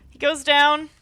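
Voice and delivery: loud, high clutch call; excited. Real, not acted. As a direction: roar it as the round is won. Caster calls a clutch win.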